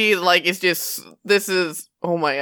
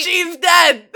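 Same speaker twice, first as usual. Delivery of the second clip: hysterical laughing; 10 semitones higher, 4 dB louder than usual. Acted. Kara laughing hysterically at corpse.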